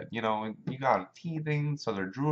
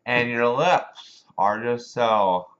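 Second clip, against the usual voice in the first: put-on slow, loud slurred drunk; boozy drawn-out pickup lines, words lurching out; 3 semitones higher, 12 dB louder, much slower than usual. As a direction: imitate a drunk clumsily chatting someone up. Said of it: "try to pick up girls when they're drunk".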